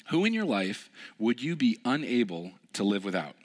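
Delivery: ordinary delivery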